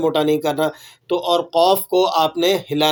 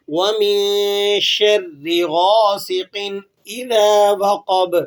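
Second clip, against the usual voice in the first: Quran recitation; 2 semitones higher, much slower than usual. Shown as a demonstration. Teacher recites the whole verse for students. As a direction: recite the verse in full tajweed. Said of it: "دوبارہ سے پوری آیت کو سن لیجئے".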